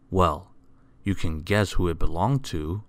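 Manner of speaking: ordinary delivery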